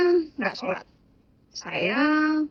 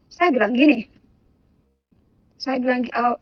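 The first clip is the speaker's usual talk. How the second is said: ordinary delivery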